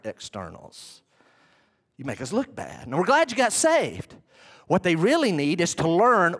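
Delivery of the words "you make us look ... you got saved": ordinary talk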